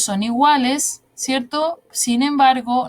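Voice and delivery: ordinary talk, nothing marked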